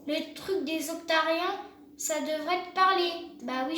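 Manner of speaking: ordinary talk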